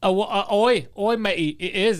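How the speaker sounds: lightly mocked English accent turning pirate. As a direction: loudly botch an English accent into pirate talk. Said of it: "that's a pirate".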